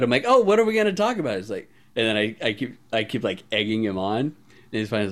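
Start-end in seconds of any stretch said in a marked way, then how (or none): 0.3-1.4 high, fast mock-innocent question; ditzy innocence. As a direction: play dumb to egg him on. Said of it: "acting super ditzy".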